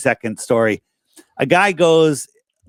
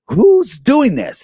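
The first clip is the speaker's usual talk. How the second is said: high, loud and slow, alarmed; mock alarmed question; 10 semitones higher, 4 dB louder, much slower than usual. Applicant demands who is behind it, high and slow alarm.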